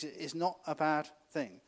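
ordinary talk

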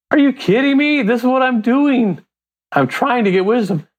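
high-pitched, incredulous retelling; exasperated protest. Retold or imitated. Man retelling his reply to Proverbs' nagging, exasperated.

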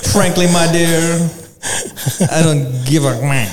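hammed-up 1940s leading man; old Hollywood voice.

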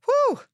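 whew. Real, not acted. Exhale after describing her spiral.

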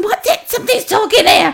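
mum, baffled. Retold or imitated. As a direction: accuse the baby of making the noise. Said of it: "she's going".

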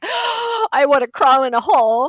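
loud, high gasp of mock mortification; gasp, then wail it in embarrassment; mortified. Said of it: "I'm, like, freaking out".

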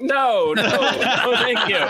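high, hasty mock-panicked no; alarmed polite refusal. Nineteen-year-old declining the homeless woman's offer, alarmed refusal. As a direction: back away with repeated high refusals.

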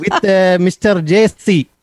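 put-on slow Indian accent; drawn-out Indian accent, showman style. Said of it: "be hard to maintain the accent the whole time".

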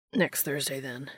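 low grunted reply, fading away. A narrator's reading. Dean being helped down the hall, grunting it out. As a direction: push it out as a low, soft grunt. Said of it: "Dean grunted out".

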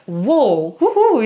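mock excited cheer at her son; excited whoop. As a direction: cheer like a mom delighted he's talking. Mom cheering son starting to talk.

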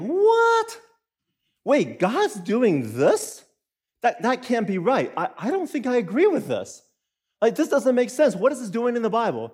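jolted, disbelieving yelp that trails into confusion; shocked reader. Retold or imitated. Churchgoer baffled by a passage, mock shocked. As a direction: play a reader stunned by a Bible verse. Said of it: "you're like".

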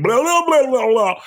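high, loud excited babbling; gushing fan babble. Retold or imitated. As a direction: gush like a starstruck fan. Excited fan gushing at Joy Reid.

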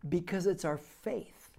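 ordinary delivery

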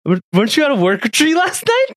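high-pitched imitation of a puzzled fiancée; questioning fiancée. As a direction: imitate a girlfriend catching out a lie.

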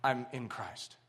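soft, low and sure, no fuss; quiet simple answer. Acted. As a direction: say it softly as the whole answer. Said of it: "is very simple".

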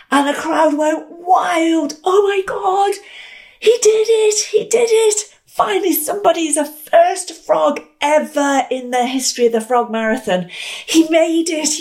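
high, shouted crowd cheer; wild cheering. Retold or imitated. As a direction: yell in joy as the crowd. Crowd goes wild at finish.

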